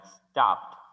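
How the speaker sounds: stopped said slowly, ending in PT; stopped as one syllable, "stopt"; final T sound. Shown as a demonstration. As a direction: end stopped on a crisp T, no extra syllable. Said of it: "se finalizaría con una T".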